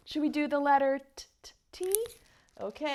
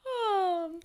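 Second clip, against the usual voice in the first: high falling vocal yawn; long sigh of a yawn sliding down; pretend yawn; 6 semitones higher than usual. Shown as a demonstration. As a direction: let out a sleepy yawn.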